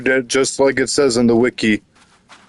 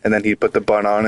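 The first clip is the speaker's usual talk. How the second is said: ordinary delivery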